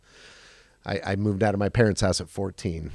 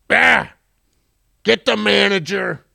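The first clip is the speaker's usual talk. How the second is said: gruff customer; 9 semitones higher, 6 dB louder than usual. Retold or imitated. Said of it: "and he goes".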